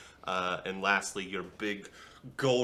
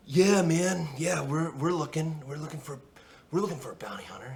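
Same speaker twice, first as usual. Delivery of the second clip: put-on laid-back young guy; 6 semitones higher, faster than usual. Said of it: "it's like".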